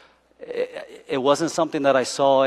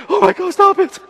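much higher mock plea; wife begging her husband to stop; exasperated wife; 4 dB louder than usual. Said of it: "she's like".

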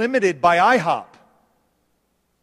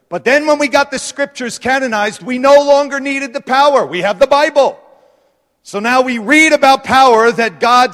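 loud, driving sermon delivery, higher pitched; preaching at full force; 5 semitones higher, 7 dB louder than usual. Real, not acted. Preacher rousing the church against a powerless gospel, loud and driving. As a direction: hammer it home to the congregation.

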